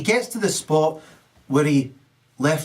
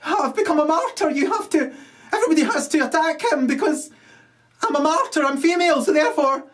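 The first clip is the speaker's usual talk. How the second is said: shrill martyr woman; 11 semitones higher, faster than usual. Acted. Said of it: "looks up and says".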